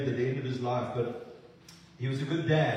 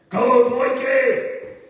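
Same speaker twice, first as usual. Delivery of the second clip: long, slow shout, much higher and louder; father bellowing encouragement as his son runs on; dad shouting; 10 semitones higher, 11 dB louder, much slower than usual. Retold or imitated. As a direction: yell it across the rugby field. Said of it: "his dad's voice said".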